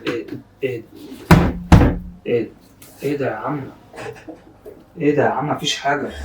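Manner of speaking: soft, low calming voice; quiet, coaxing reassurance; soothing. Real, not acted. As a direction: gently hush a crying friend. Calming a crying friend.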